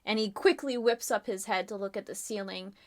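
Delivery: ordinary talk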